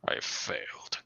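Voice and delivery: weak dying whisper. Acted. Dying brother's last words.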